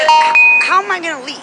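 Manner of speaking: high, loud indignant retort; exasperated outburst. Retold or imitated. Arguing with rude motel clerk.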